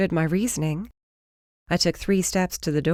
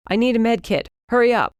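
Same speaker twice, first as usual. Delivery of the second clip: urgent call; 5 semitones higher, 4 dB louder, faster than usual. Acted.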